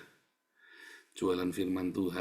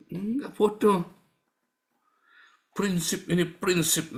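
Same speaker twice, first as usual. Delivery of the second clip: scornful; 8 semitones higher, slower than usual. Acted. Mocking correction of own word.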